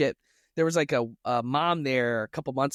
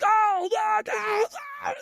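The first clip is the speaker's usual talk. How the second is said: screaming cashier; slower than usual. Retold or imitated. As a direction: wail it like a panicked cashier. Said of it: "screams when he talks".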